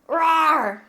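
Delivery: loud cutesy rawr; playful kitten roar; cute anime streamer. Acted.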